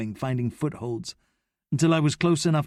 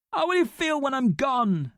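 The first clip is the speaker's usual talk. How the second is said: much higher, wounding question; cruel taunt; 13 semitones higher than usual. A narrator's reading. Noah cruelly taunting Abdi by the canal, much higher. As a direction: stab him with it.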